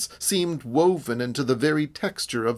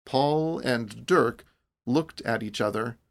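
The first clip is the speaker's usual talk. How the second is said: plain reading voice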